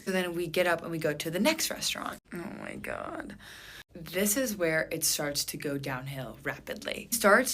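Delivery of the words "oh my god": low, slow, breathy groan; breathless dread. Real, not acted. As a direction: sigh it out in dread. Dreading the next restaurant.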